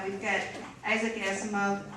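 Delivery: ordinary talk, nothing marked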